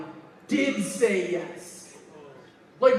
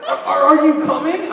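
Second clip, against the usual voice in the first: anxious loud question; 6 semitones higher, 9 dB louder than usual. Pretend. Alkali pleading on phone.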